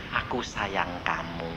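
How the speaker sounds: low, soft and slow loving words; tender Jesus voice. Retold or imitated. Jesus stroking the preacher's head, low tender murmur. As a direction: speak like a gentle Lord comforting a sinner.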